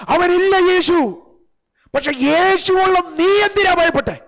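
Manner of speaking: high, loud, rebuking call; fiery preaching. Acted. Preacher scolding the fearful congregation, loud and high. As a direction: drive the question home like a revival preacher.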